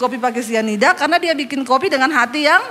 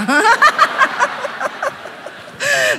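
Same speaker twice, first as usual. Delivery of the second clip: long, high laugh at her own story; laughing at the rude answer; high burst of laughter; 10 semitones higher than usual. Real, not acted. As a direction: crack up laughing. Congregation-style burst.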